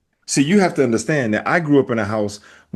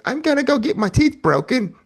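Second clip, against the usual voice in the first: much higher silly mom voice; mock cheerful mom; 13 semitones higher than usual. Teasing friend by voicing his mom, silly high voice.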